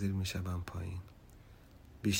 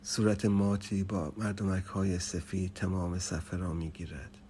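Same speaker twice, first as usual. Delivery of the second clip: usual speaking voice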